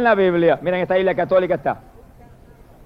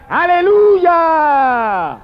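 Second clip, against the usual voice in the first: long, high, ringing cry; loud, drawn-out, higher exultant shout; shouted hallelujah; 7 semitones higher, 4 dB louder, much slower than usual. Acted. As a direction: stretch it out like a heavenly crowd. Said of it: "y gritaban".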